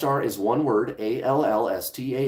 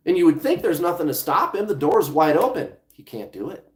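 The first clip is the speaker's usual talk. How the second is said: normal talking voice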